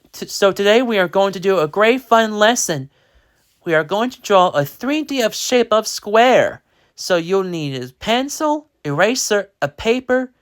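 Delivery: high, bright classroom announcing voice; a teacher kicking off a fun lesson, high and lively; cheery teacher. Acted. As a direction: greet the class like an upbeat art teacher.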